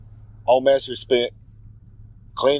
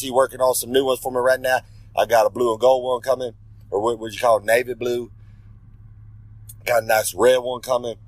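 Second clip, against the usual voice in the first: ordinary talk, nothing marked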